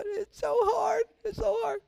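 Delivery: high, slow mock-crying whine; mock whimper. Pastor jokingly whining to the congregation, high mock sob. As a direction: whimper it like a pouting child, hammed-up.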